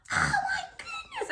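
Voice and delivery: high, squeaky, soft wail; mock pained cry. Mother retelling her labour pains, high whimpering cry. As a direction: squeal it thinly as if in agony.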